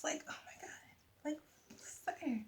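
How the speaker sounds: soft unvoiced oh my God; shocked whisper. Real, not acted. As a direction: breathe it out, stunned. Reacting to a sad passage.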